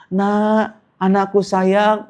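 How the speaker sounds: high, slow, syrupy "anakku sayang"; a parent sweet-talking a grown adopted child; mock doting parent. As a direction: coo it like a doting father, hammed up.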